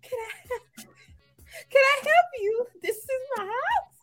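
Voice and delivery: quoted homeowner. Retold or imitated. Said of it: "He's like".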